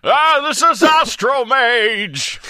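high, slow booming character read; slow, loud, high theatrical read; hammed-up forum voice. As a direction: boom it out like a grand wizard. Reading a forum post in character.

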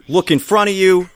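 normal talking voice

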